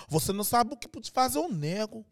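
singsong Brazilian intonation; Brazilian Portuguese accent. Shown as a demonstration. Comedian imitating his capoeira teacher, singsong Brazilian Portuguese. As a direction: lilt it like a Brazilian. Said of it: "eux autres, ils chantent".

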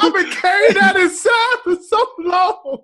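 high, loud mock-crying confession; hammed-up hurt. Man unburdening himself to his friends, hammed-up hurt. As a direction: sob it out as a long-buried wound. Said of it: "I'm so hurt".

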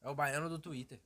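a hint of the Salvador teen accent; Bahian accent. Bahian accent, describing his own teen Salvador persona. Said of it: "um talento meu é imitar baiano".

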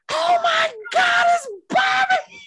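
very high, stretched-out squealing shout; imitated fangirl scream. Daughter realizing who is on the phone, imitated ecstatic scream. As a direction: shriek it slowly like a starstruck fan.

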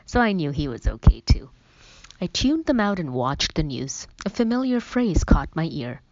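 ordinary delivery

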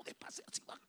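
very soft, secretive whisper; gossiping whisper. Shown as a demonstration. Preacher showing how one gossips, whispering. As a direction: demonstrate a hushed gossip voice. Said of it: "así habla uno cuando está chambreando".

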